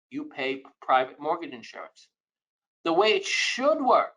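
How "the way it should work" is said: should lifted high and leaned on; stress on should. Shown as a demonstration. Teaching homebuyers, lifts should high for emphasis. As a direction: lean hard on should in "The way it should work". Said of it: "I emphasize the word should".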